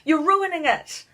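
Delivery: high, desperate yell; boy yelling to stop the book. A narrator's reading. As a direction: shout it up high. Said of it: "Louis yelled".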